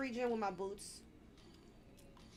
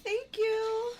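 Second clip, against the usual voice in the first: high, loud, overwhelmed thanks; relieved squeal; 12 semitones higher, 6 dB louder than usual. Real, not acted. Player thanking whoever saved them, high relieved squeal. Thanks teammate after scare.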